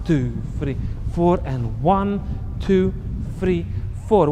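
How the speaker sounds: counting the steps for the class; counting beats. Shown as a demonstration. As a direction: hit "four" hard, drop on "two, three". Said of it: "now we have tap on one".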